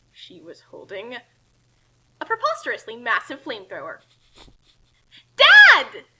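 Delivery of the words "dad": loud high yell across the room; shout. Acted. Kasumi shouting for her dad. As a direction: yell it out big. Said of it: "Kasumi shouted".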